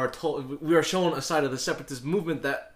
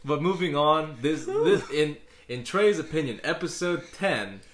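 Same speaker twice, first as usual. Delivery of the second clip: laughing through the words; giggly, barely holding it together; awkward joy; 4 semitones higher, 4 dB louder than usual. Real, not acted. Laughing while introducing the episode.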